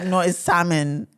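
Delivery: "salmon" as SAM-un, drawn out; silent-L correction. Retold or imitated. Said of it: "people will correct me and be like".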